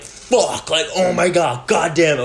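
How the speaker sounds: loud, high burst of cursing; an angry dad's swearing, imitated; mad cursing. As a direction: curse out loud like a furious dad. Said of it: "like, gets so mad".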